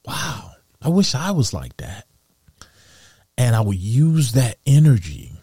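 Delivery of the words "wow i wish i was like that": breathy "Man", then a higher, quicker gush of awe; lightly voiced awestruck admirer; admiring listener. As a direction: gush like an impressed friend hanging on every word. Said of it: "you see their eyes light up like".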